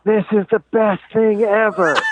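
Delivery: put-on high, slow, delighted child's voice; thrilled daughter. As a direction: savour it like a kid loving the drop. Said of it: "And she goes".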